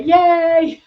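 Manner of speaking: high, loud, happy cheer; delighted cheer. Real, not acted. Cheering a viewer's kind comment.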